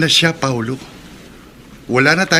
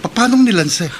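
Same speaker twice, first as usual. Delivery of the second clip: shocked stammer; 9 semitones higher than usual. Acted.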